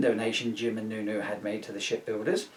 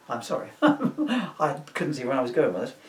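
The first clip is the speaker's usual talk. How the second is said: ordinary delivery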